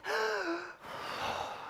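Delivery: sharp high inhaled gasp. Acted. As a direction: gasp in a big breath. Take a deep breath.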